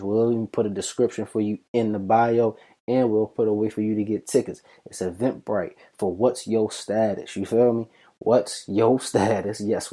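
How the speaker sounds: normal talking voice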